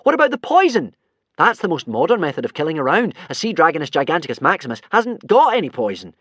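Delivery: high, loud urgent pitch; urgent shout. Acted. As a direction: push the idea loudly. Hiccup urgently continues shrieking.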